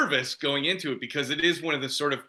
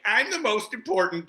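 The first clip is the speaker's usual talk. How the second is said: higher-pitched, laughing self-importance; selfish self-importance; 7 semitones higher than usual. Retold or imitated. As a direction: play up selfish entitlement, pitched up. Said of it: "you know, selfish, like".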